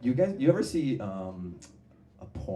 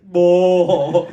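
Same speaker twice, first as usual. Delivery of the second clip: put-on loud, high, blunt accented yelp; Eastern European moan; 8 semitones higher, 15 dB louder than usual. Eastern European porn actress yelping during sex. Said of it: "she'll be like".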